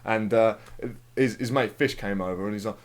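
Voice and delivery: normal talking voice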